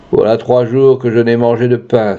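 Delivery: ordinary delivery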